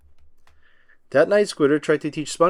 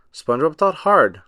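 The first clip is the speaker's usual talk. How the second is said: ordinary delivery, nothing marked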